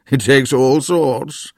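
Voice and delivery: put-on slow, wry old man; knowing joke. A narrator's reading. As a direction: deliver the quip smugly. Grandpa joking.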